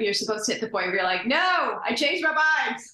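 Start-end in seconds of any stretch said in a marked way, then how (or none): 1.3-2.8 high, loud half-joking protest; mock change of heart. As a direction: blurt it out like a mom mid-labor who wants out. Mock labor outburst quote.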